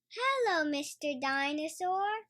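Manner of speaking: put-on a small girl greeting her brother's toy; Peppa voice. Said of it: "says Peppa".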